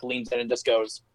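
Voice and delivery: ordinary talk, nothing marked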